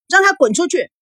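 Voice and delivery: high, exasperated demand; daughter's frantic, angry command; flustered angry yell. A narrator's reading. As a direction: cry out for him to be thrown out. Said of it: "孙娜也气急败坏地喊道".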